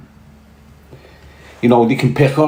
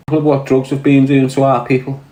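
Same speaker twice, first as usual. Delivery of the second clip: ordinary talk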